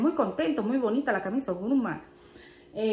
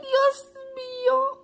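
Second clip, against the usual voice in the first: 1 playful shock; 13 semitones higher, much slower than usual. Real, not acted. Shocked at own cheeky idea.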